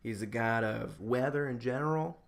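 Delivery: usual speaking voice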